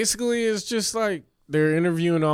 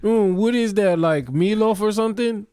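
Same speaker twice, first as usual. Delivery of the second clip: nosy gangster; 7 semitones higher, 4 dB louder than usual. Pretend. Mock gangster sniffing dinner.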